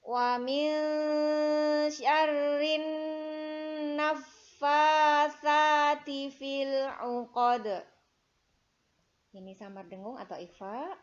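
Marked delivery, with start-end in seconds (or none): 0.1-7.7 slow, loud melodic chanting; Quran recitation. Shown as a demonstration. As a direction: chant it slowly like murottal. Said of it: "Ayat keempat".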